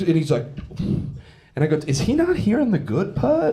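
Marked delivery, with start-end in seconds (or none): 1.9-3.5 high, incredulous plea; baffled appeal. Retold or imitated. Comic appeals to the buddy breaking up the fight, baffled. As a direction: pitch way up in comic bewilderment.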